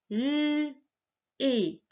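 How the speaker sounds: "L" as a long held lll, then a separate "et"; sounding out. Shown as a demonstration. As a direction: hold the consonant "L", pause, say "et". Teacher sounds out a syllable.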